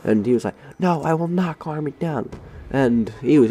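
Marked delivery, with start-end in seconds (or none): 0.8-2.3 mock mad teacher. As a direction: imitate a teacher refusing to be calmed. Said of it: "he was just going mad".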